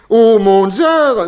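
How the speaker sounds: hammed-up plea to his sovereign; quoting the count. Boarder quotes the count addressing the tsar, solemn. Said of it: "le lui a-t-il dit".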